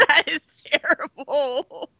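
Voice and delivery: laughing. Real, not acted. Laughing at the awful taste.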